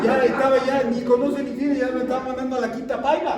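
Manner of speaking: loud, high impassioned preaching; preaching at full force. Acted. Pastor preaching to the congregation, loud and fervent. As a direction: build to a fervent climax, crying out.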